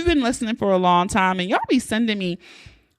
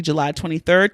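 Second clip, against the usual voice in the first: ordinary delivery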